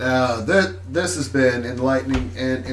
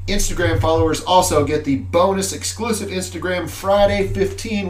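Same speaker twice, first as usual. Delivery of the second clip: ordinary talk, nothing marked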